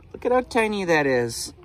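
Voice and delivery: real delight; marvelling. Real, not acted. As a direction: marvel at how small it is. Marvelling at tiny egg.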